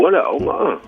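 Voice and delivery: ordinary delivery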